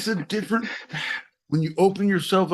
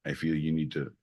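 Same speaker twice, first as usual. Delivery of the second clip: mock soothing; 13 semitones lower, 4 dB quieter than usual. Soothing partner, shh.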